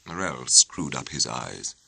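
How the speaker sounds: plain reading voice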